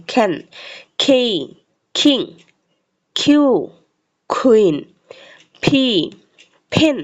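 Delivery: letter then word, pauses between, puff of air on ফি and ফেন; example words. Shown as a demonstration. Teacher models example words for learners, careful pronunciation. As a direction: read each item separately and carefully. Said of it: "উচ্চারণ সহকারে দেখিয়ে দিয়েছি".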